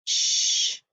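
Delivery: "sh" hissed alone, voiceless and held; isolated sound. Shown as a demonstration. Teacher modelling the sh sound for kids, hushed hiss. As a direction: breathe out a long "sh". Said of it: "Digraph S H".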